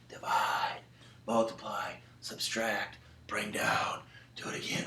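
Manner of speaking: hushed, whispery sleep-talk; sleepwalker mumbling. Acted. Sleepwalking child answering a parent, whispered sleep-talk. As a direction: chant it softly as if asleep. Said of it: "first sleepwalking lesson".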